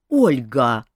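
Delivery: indignant outburst, low and loud; king scolds Olga, indignant low call. Acted. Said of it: "возмутился король".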